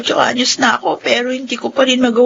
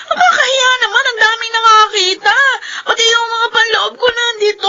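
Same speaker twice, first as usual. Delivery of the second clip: higher, pleading half-cry; distressed near-crying complaint; tearful protest; 8 semitones higher than usual. Acted. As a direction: beg her grandmother to stop shaming her. Clothes thrown out, humiliated.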